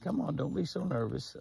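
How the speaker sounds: high, soothing coax to a nervous buck; coaxing call to deer. Real, not acted. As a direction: settle him with a high friendly call.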